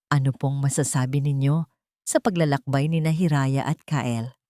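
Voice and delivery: plain reading voice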